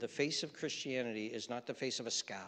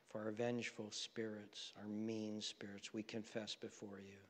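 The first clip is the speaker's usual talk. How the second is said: prayer; 5 dB quieter than usual. Acted. Pastor praying for the congregation, hushed and earnest. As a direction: lead the room in quiet confession.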